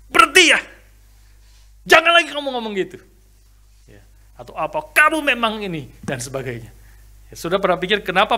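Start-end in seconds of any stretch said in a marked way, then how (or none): 0.1-3.0 loud, high shouting in a quarrel. Retold or imitated. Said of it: "orang itu kalau lagi bertengkar itu suaranya jadi keras". 4.9-5.9 loud, high accusing snap; quarrel shout. Retold or imitated. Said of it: "ngomongnya kenceng, makin kenceng".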